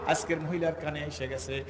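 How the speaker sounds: ordinary talk, nothing marked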